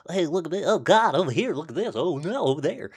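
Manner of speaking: mocking. Mocking the ones who think they're so clever, mocking. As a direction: mimic someone showing off, in a high silly voice.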